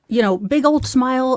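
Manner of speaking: ordinary talk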